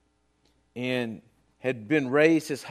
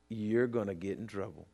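little inner voice; 11 semitones lower, 11 dB quieter than usual. Retold or imitated. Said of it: "a little voice saying".

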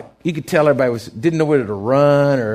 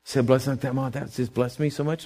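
grateful guest, soft; 4 semitones lower, 8 dB quieter than usual. Retold or imitated.

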